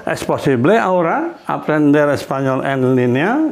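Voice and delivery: rising end on "línea"; teacher modelling a question tune. Shown as a demonstration. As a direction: ask it with a clear lift at the end. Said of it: "dengan nada tanya".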